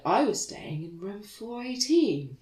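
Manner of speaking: slow, drawn-out weary delivery; tired voice. Retold or imitated. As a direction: drag the words out, exhausted. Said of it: "tired voice that you can do".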